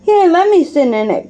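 lightly voiced excited boy; eager cry. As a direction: jump in like a kid who wants first turn. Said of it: "cried Mike".

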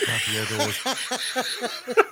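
slow solemn liturgical intoning; priest voice. Shown as a demonstration. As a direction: drag it out like a priest at Mass. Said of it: "Listen to it".

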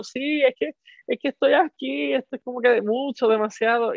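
high-pitched mock tearful explaining; acted overwhelm. Answering the kind couple while crying, re-enacted overwhelm. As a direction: explain yourself in a high, overcome voice.

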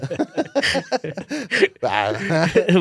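high, helpless burst of laughter; cracking up at his own joke; loud laughter. Real, not acted. Host laughing at his own mean joke, loud helpless laughter.

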